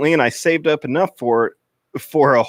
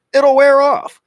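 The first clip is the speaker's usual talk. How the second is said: loud, much higher cheerful call; over-eager reassurance; 11 semitones higher, 8 dB louder than usual. Acted. Steve loudly reassures his freaked-out friend, high and eager. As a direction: push the comfort way too hard.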